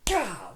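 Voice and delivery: sharp, loud exasperated cry; loud frustrated gah. Acted. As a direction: blurt it out in envy. Tony's frustrated outburst.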